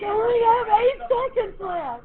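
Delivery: hammed-up wail of a student running out of time; mock panic.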